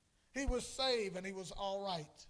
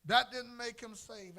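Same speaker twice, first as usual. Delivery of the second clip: louder, higher repeat; preaching emphasis; 7 semitones higher, 8 dB louder than usual. Real, not acted. Preacher repeating his point to the church, louder and higher. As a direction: hammer the point home.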